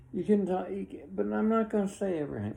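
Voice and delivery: high, fast, worked-up protest; excited. Real, not acted. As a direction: rush it out, all fired up.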